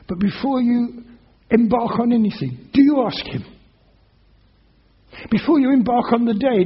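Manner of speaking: earnest appeal from the pulpit; urgent preaching. Acted. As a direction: challenge each listener directly. Preacher presses hearers with questions.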